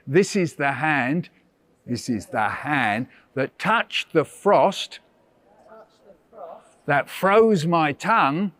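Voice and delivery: slow, deliberate poem reading; slow poem recitation. Shown as a demonstration. Teacher reciting a poem for children to repeat. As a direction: recite each line slowly, clearly. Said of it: "here's a poem now about your hand".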